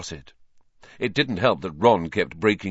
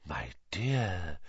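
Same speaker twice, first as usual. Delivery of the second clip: much softer breathy address; breathed; 9 dB quieter than usual. A narrator's reading. Trelawney breathing to Harry, hushed and ominous. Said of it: "Professor Trelawney breathed".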